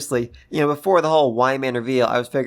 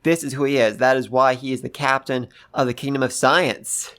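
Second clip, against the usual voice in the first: normal talking voice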